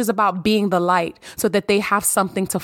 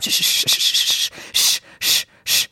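shushing. Pretend. To a mad partner, asking for a hug: whispered shushing. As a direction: hush them gently, all breath. Soothing partner during an argument.

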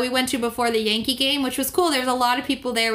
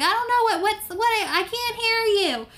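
put-on frustrated old lady; 10 semitones higher than usual. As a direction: play a confused grandma yelling at her phone.

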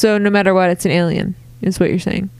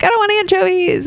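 whiny picky eater; 11 semitones higher, faster than usual. Retold or imitated. Picky caveman eater quote.

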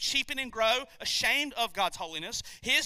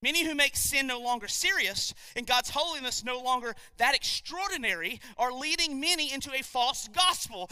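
ordinary delivery

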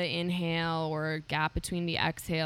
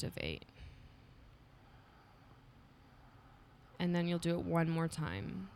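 calm, unhurried breathing-coach voice; guided breathing. Acted. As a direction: guide them through each breath gently. Leads a breathing exercise.

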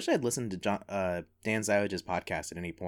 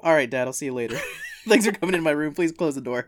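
louder, higher, laughing through a mock teen brush-off; playing the son; 6 semitones higher, 9 dB louder than usual. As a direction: son shooing dad out of his room, laughing. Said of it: "you're gonna play you".

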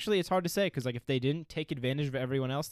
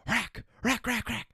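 higher-pitched barking noises; imitated dog bark; 8 semitones higher, slower than usual. Shown as a demonstration. Showing a friend what dogs sound like, imitated bark. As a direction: bark like a dog in short bursts. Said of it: "That's more like what I hear".

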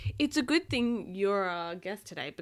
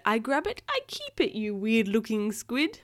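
higher, louder creature quote; squid character voice; 3 semitones higher, 6 dB louder than usual. Acted. Parent quoting the squid for a child, animated character voice. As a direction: play the grabby sea creature. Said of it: "'cause he said".

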